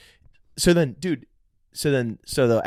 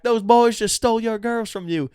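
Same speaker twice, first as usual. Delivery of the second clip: mock Southern disbelief, pitched way up; Southern guy; 11 semitones higher, 3 dB louder than usual. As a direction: put on a high Southern guy voice. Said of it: "these Southern guys".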